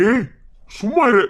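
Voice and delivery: angry shout. Acted. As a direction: cut her off with a furious shout. Uncle snaps at crying aunt.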